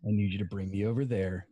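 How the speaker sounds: very deep, quick order; Lex. Acted. Lex asking a henchman for a lift, deep. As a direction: give the order from low in the chest. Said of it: "I can do Lex".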